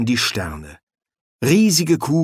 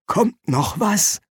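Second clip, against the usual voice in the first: stifled, slow, higher-pitched question; choked voice; 8 semitones higher, slower than usual. Acted. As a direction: ask warily in a smothered voice. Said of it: "sagte die erstickte Stimme des Dekans".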